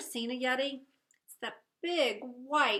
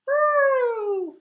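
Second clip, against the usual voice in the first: much higher, louder whoop; excited woo; 13 semitones higher, 11 dB louder than usual. Acted. New friends coming out to play, a happy woo. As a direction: whoop it with delight.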